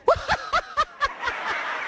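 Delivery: audience bursting into laughter; big crowd laugh. Real, not acted.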